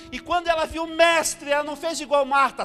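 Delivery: high, loud impassioned sermon; fervent preacher crying out; preaching at full force. Real, not acted. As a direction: build to a shouted, drawn-out climax.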